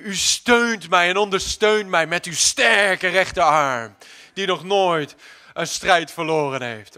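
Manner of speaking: loud, slow, declaiming; impassioned slow prayer; fervent preaching prayer. Acted. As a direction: proclaim your trust like a battle cry.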